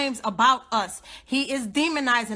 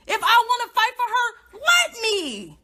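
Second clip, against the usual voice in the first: loud; 8 semitones higher, 3 dB louder than usual. Real, not acted. Angry rant at hostile commenters.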